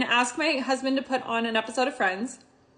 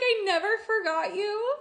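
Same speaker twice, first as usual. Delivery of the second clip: tearful quote; 7 semitones higher, 5 dB quieter than usual. Real, not acted. Quoting the reunion line while teary.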